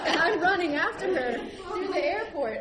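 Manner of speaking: slow, high, through laughter; laughing narration. Real, not acted. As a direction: tell it while cracking up. Laughing while retelling.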